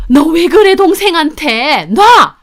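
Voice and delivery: high, loud furious protest; shrieked outburst in a family fight; angry shouting. Acted. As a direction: yell at them to let go. Protest as sister is dragged out.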